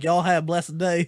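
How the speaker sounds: imitated Southern accent; playful put-on Southern accent; Gumbo's accent. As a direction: mimic a folksy Southern manager. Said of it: "that's my old general manager's accent".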